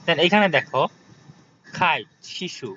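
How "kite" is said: "খাইট" as English kite with a puffed k; airy burst on the k of "খাইট"; aspirated K. Shown as a demonstration. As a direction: say "খাইট" with a kh onset. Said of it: "এটা খ উচ্চারণ নিয়ে আসবে".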